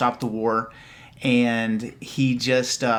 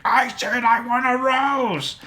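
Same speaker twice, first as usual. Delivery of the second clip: high-pitched mock Yoda yell, slow; 12 semitones higher, slower than usual. Yoda yelling at Dooku for a rose, high mock yell. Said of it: "he's, like, yelling at him".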